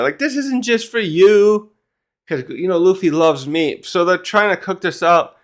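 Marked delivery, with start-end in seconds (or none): 0.2-1.7 higher-pitched, mildly scolding retort; lightly acted telling-off, drawn out; mock-scold with a lifted pitch; reprimanding. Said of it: "the Goldberg's reprimanded".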